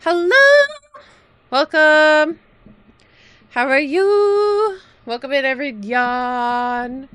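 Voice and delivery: very high, loud sing-song welcome; cutesy greeting voice. Pretend. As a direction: greet them like a bubbly mascot. Cutesy greeting to new viewers.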